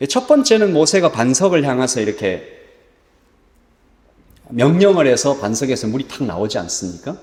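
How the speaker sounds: ordinary talk, nothing marked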